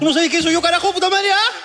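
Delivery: high-pitched do-you-know-who-I-am rant; mock outrage. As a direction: pull rank in a hammed-up fury. Acts self-important customer raging.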